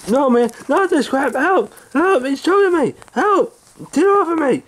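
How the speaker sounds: very high, frantic pleading; panicked cry for help. Acted. Man being choked in a scuffle, panicked. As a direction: cry out as if being grabbed by the throat.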